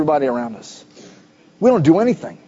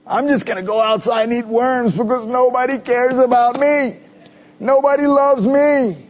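high, put-on pouting voice, higher pitched; woe is me whine; 5 semitones higher than usual. Pastor mocking a self-pitying man with a high whiny voice. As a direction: whine like a sulky child about eating worms. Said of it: "He's got that woe is me mentality".

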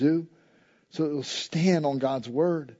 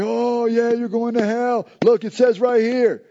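mock Bible thumper; 4 semitones higher, 3 dB louder than usual. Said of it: "Man, don't stand there and be like".

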